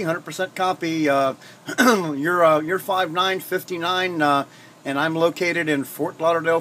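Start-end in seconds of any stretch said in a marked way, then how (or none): none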